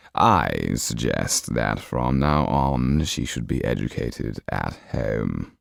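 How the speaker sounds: very slow, low and silky; sly Count. Acted. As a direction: savour each word, amused. Count advises Marquis.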